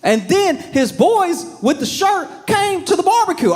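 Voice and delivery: high, excited yell over the story; near-shouted with excitement. Real, not acted.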